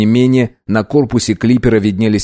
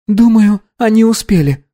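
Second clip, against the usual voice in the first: ordinary delivery, nothing marked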